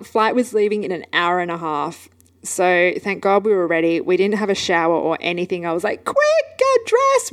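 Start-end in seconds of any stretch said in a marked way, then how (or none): 6.0-7.3 high-pitched, drawn-out mock yell; urgent hurrying. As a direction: re-enact a panicked call to hurry up. Retold rushing daughter to dress.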